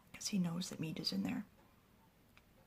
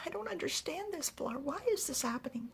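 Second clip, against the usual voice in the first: high, bewildered grieving plea; acted distraught plea; 11 semitones higher than usual. Grieving Mead begs Valar to explain, acted distraught plea. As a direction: pitch it right up, pleading for an explanation.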